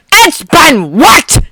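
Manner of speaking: loud, slow, disbelieving yell. Acted. Bakugo yelling on hearing his room was ransacked. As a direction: shout it in disbelief. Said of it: "Bakugo yelled".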